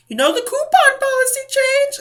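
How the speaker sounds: high, pointed cashier voice; mock cashier pestering the customer. Cashier voiced in coupon story.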